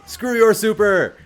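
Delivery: loud, high triumphant yell; gloating shout. Real, not acted. Streamer to the enemy who died, gleeful shout.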